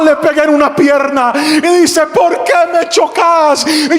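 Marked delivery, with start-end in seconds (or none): 2.1-3.7 high, drawn-out protest of a man just hit; protesting thief. Acted. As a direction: cry it out in outrage, stretched. Thief acted out after being hit.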